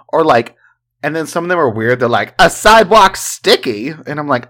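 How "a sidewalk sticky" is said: loud, high put-on quiz voice; hammed-up, raised-voice quote; mock announcing. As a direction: blurt the odd term out, high and loud. Mocks a weird quiz term.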